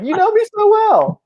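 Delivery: very high-pitched imitation of a friend; hammed-up high mock-friend voice; Tyler impression. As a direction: mimic your buddy, pitch leaping way up. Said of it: "You sounded just like him right there".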